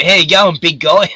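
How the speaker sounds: loud, high-pitched hail to a big guy; imitated hearty greeting; mock matey greeting. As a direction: call it out high and loud like an overfamiliar bloke. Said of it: "I get called".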